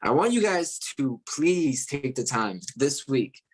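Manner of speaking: normal talking voice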